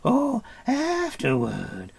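very slow, high wail; dismayed creature cry. A narrator's reading. Gurgi dismayed at waiting for food, high slow wail. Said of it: "cried Gurgi".

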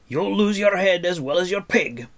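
high, loud dwarf growl. Acted. Dwarf warns Taran against searching, growling. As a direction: growl the warning loudly. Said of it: "growled Dolly".

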